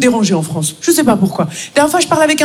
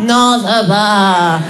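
glum; 5 semitones lower, much slower than usual. Retold or imitated. Grumpy colleague Martine.

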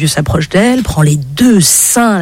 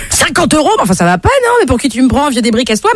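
indignant refusal; 8 semitones higher, much faster than usual. Acted. Girl angrily rejects the old man.

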